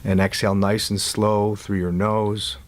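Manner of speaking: calm guide with long silences between instructions; guided meditation. Real, not acted. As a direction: ease them into letting go, unhurried.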